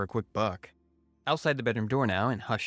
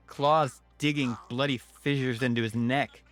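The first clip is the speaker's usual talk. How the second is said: ordinary delivery, nothing marked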